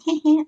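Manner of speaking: high mock giggle; put-on bashful chuckle. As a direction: imitate a flattered guy's little heh-heh. Imitating manga guy's bashful chuckle.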